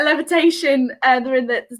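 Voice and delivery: ordinary delivery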